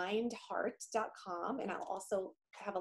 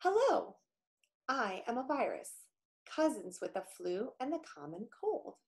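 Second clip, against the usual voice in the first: lightly voiced friendly virus; a hint of a cheerful little germ; cute germ voice; 3 semitones higher than usual. Reading the virus's first-person story.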